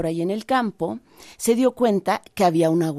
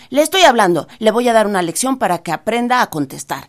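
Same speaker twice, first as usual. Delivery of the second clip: high rabbit voice losing patience, louder; angry, desperate rabbit; 3 semitones higher, 5 dB louder than usual. Acted. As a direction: threaten the silent scarecrow, fed up. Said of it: "ya enojado y un poco desesperado".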